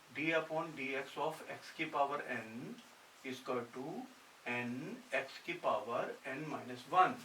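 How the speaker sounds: each symbol spelled out slowly for copying; slow, deliberate teacher dictation. Shown as a demonstration. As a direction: read the formula out word by word, very slowly. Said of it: "ये formula याद रखना है".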